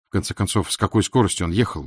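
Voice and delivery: plain reading voice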